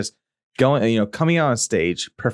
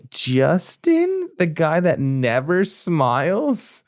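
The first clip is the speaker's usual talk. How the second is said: incredulous; 7 semitones higher, much slower than usual. Retold or imitated. Stunned reply in retold bathroom chat.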